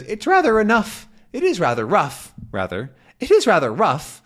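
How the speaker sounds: high, puzzled mumble; lightly voiced doddery professor; mishearing professor. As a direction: deaf old man misheard. Calculus mishears.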